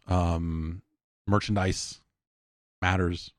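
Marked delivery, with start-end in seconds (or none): none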